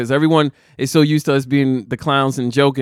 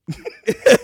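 sudden high laugh; 3 dB louder than usual. Real, not acted. Laughs at own awkwardness.

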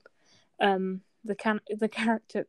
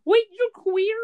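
high, loud cartoon character line; acted detective; 10 semitones higher, 7 dB louder than usual. The detective challenging someone in the street, high mock voice. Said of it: "he's like".

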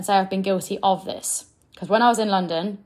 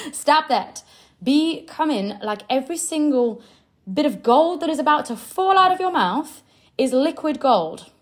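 usual speaking voice

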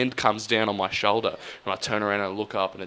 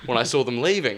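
ordinary talk, nothing marked

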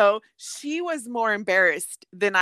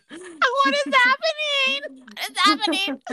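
high, slow drawn-out disbelief; mock bewildered wail, much higher; incredulous; 4 dB louder than usual. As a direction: stretch it out, amazed at the absurdity. Disbelief at the bathroom scene.

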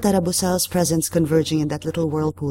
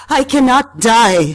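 high, loud, anguished railing; wailing outrage; 6 semitones higher, 8 dB louder, slower than usual. A narrator's reading. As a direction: rail against death, drawing the words out. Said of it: "she railed".

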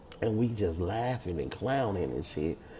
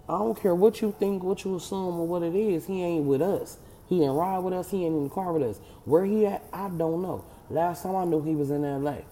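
defiant dismissal; 7 semitones higher than usual. Retold or imitated. Retold argument, shutting her down.

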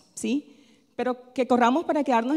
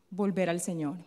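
usual speaking voice

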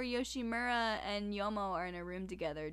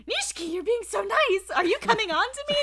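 high, louder teasing voice, quick at the end; waitress teasing a suddenly nice coworker; mock flirty tease; 10 semitones higher, 9 dB louder than usual. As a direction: tease him like he's hitting on you. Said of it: "she's like".

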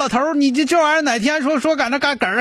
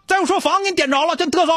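put-on higher-pitched scolding of an old father; mock scolding; 7 semitones higher, faster than usual. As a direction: tell him off like a naughty kid. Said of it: "你就说：".

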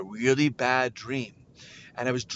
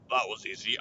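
talking button; 6 semitones lower than usual. Retold or imitated. The button speaks.

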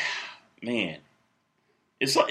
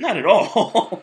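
laughing; 6 semitones higher, 3 dB louder, much slower than usual. Real, not acted. Laughing denial, laugh heard.

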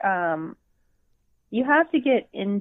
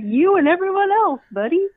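mocking retort; 10 semitones higher, 5 dB louder than usual. Mock retort to a character.

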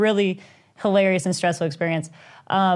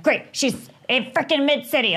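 higher, exasperated mock panic; retold freak-out; 6 semitones higher than usual. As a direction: act out your own overreaction. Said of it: "Like, I'm freaking out".

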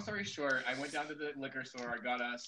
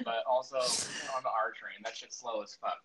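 ordinary talk

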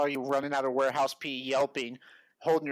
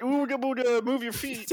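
mock scary monster; 7 semitones higher than usual. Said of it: "You're like".

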